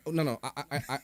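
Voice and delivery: usual speaking voice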